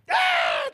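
a sharp high yelp from inside the house; mock cry of the fight behind the door; imitated shout.

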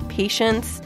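ordinary delivery

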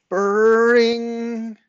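high, loud imitated doorbell; doorbell ring. A narrator's reading. Doorbell startles the boy, high loud ringing imitation. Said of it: "it was only the doorbell".

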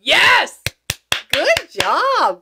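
loud, high triumphant shout; thrilled. Real, not acted. Medium's reading confirmed by client, thrilled shout. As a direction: shout it high, celebrating a hit.

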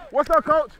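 ordinary delivery

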